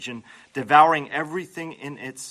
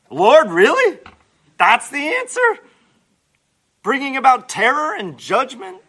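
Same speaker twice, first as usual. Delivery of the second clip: loud, high disbelief at God's answer; can't believe what he's hearing; loud shocked objection; incredulous protest; 9 semitones higher, 9 dB louder than usual. Acted. Habakkuk protests God's plan.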